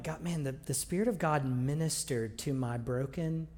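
ordinary delivery

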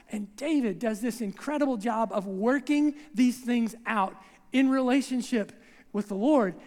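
usual speaking voice

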